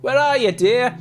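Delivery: put-on high, loud calling in a muddled northern English accent; worried father shouting for his lost daughter, hammed-up accent; vague put-on accent. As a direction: call out for Cheryl in a confused regional accent. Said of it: "I don't even know what accent I was trying to go for".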